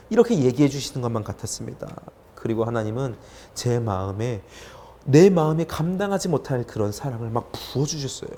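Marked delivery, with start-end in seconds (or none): none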